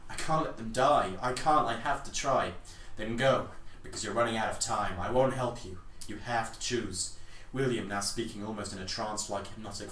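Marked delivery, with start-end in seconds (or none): 0.1-2.5 louder, higher desperate cry; desperate outburst. Acted. Desperate plea to save lives. 3.0-7.1 louder, cold angry push-back; angry retort. Acted. Angry reply in the quarrel.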